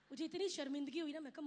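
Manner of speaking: ordinary delivery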